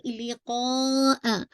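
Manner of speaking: लिक़ा drawn out slowly; two count stretch. Shown as a demonstration. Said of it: "टू count". (लिक़ा को लंबा खींचना)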